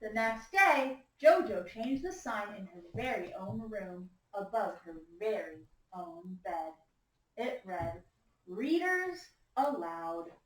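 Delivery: slow, emphatic storytime reading with swinging pitch; story read-aloud to children. A narrator's reading. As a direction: read it to a class of young listeners. Reads picture book to class.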